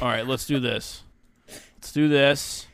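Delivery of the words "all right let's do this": normal talking voice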